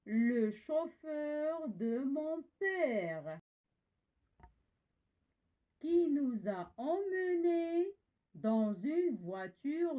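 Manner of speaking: poem recitation. Shown as a demonstration. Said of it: "je vais lire le poème".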